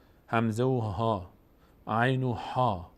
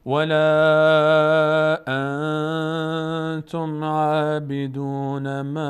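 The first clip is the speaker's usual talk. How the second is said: loud, very slow chanted recitation; Quran recitation; 4 semitones higher, 8 dB louder, much slower than usual. Acted. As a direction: chant it out like a qari.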